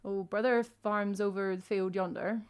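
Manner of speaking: thick rural Cornish farmer; broad old Cornish countryman; old man Cornish accent. Shown as a demonstration. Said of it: "that was like an old man Cornish accent".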